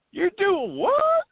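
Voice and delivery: high, loud, drawn-out mock Tennessee disbelief; Tennessee accent. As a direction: mimic an incredulous local, slowly, in a Tennessee accent. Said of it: "trying to do my best Tennessee accent".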